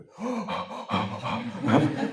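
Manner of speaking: mock outraged gasping for air; imitated breathless gasps. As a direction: gasp repeatedly as if too worked up to breathe. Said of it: "Could not breathe anymore".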